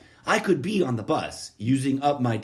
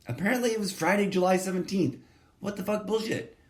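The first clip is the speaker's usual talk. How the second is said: shocked disbelief; 9 semitones higher, faster than usual. Real, not acted. Shocked at the date found online.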